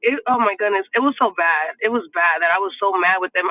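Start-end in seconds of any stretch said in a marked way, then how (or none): none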